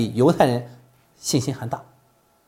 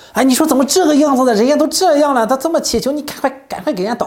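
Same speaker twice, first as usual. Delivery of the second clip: indignant protest; 9 semitones higher, 4 dB louder than usual. Acted. Preacher protests Jesus' silence.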